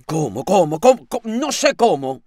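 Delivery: impatient retort. A narrator's reading. Landlady snapping back at her timid lodger, exasperated. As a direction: fling the word back impatiently.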